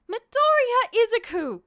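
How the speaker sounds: high furious yell of his full name; angry shout. Acted. Mother shouting her son's full name in fury.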